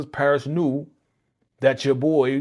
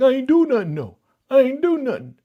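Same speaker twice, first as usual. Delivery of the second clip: much higher, louder whiny denial; mock defensive denial; 7 dB louder than usual. Caught boyfriend whining his innocence to his girlfriend. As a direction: plead innocent in a squeaky voice. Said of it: "He's like".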